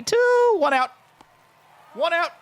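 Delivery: squeaky, loud high yelp; high squeal. Real, not acted. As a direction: let the word jump into a squeal. Scared of the batter.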